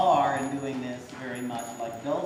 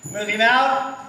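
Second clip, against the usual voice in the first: calling out; 5 semitones higher, 8 dB louder than usual. Real, not acted. Instructor calling to the rider.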